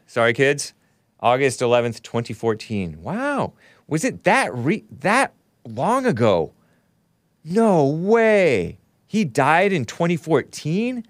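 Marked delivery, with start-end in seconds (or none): 3.0-11.1 high, swooping disbelief; real astonishment. Real, not acted. Host learning how long ago he died, real astonishment. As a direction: stretch it out in amazed disbelief.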